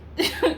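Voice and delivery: short high disbelieving laugh; burst of laughter. Real, not acted. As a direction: laugh out loud at how absurd it is. Laughing at the absurdity.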